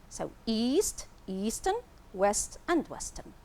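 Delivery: normal talking voice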